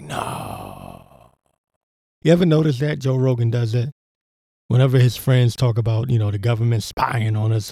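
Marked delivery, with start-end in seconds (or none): 0.0-1.3 much lower, much softer drawn-out no; a hushed fake-shocked no; mock feigned denial. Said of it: "you're just like".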